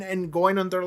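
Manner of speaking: ordinary talk, nothing marked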